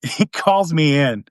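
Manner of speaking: ordinary talk, nothing marked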